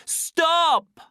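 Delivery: very high, loud, held cry; loud, ringing shout. A narrator's reading. Savage interrupts the soma distribution, ringing shout. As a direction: call out to stop a crowd. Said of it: "in a loud and ringing voice".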